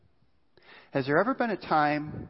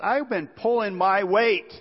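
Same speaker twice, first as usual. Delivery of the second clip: put-on louder, higher indignant older brother; indignant complaint; 8 semitones higher, 7 dB louder, slower than usual. Older brother protesting to his father, indignant and loud. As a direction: protest hard that you've done your share.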